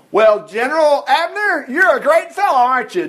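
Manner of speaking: high, singled-out mock praise; jeering call to a rival general; taunting. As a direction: taunt him with fake admiration. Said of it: "David taunted".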